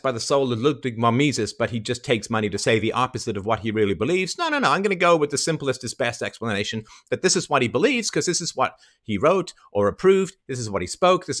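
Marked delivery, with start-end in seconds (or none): none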